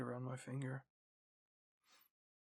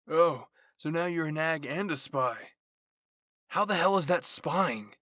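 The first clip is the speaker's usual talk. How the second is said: loud bickering; 3 semitones higher, 11 dB louder than usual. Acted. Jean and Aaron keep bickering.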